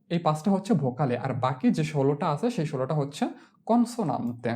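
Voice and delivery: ordinary delivery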